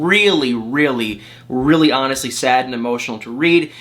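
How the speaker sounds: ordinary talk, nothing marked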